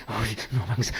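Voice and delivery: low, mock-weary grumble; a drivers' whiny moan in traffic; imitating a complaint. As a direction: sigh it like a stuck driver. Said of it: "¿Qué hacen las personas?".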